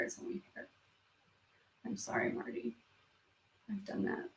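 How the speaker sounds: very soft, almost voiceless; choked up. Real, not acted. Streamer consoling a viewer who lost hair, choked up. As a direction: voice gives out to a near whisper.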